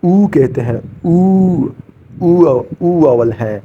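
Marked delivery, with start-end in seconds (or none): 1.0-1.7 "او" as a long "oo"; higher, drawn-out rounded "oo" for "او"; seventh vowel sound. Shown as a demonstration. Teacher showing learners the seventh Korean vowel, "او" as long "oo". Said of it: "ساتواں جو ہے اس کو".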